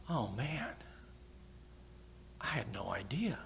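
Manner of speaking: mock-shocked whisper, half playful; dramatic whisper. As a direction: breathe it out as if stunned by the news.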